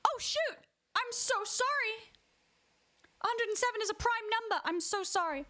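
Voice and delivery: high-pitched, playful silly voice. Pretend. Streamer remembering the silly voice for chat, high and silly.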